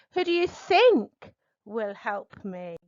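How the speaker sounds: peak the voice on "think" then drop away; stress on THINK. Shown as a demonstration. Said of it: "listen for inflections ... to see the important word".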